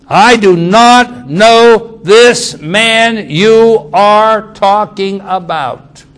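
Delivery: high, loud, very slow shout; shouted denial. Acted. Peter swearing to the bystanders he doesn't know the man, shouted. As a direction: bellow it like a man cornered and cursing.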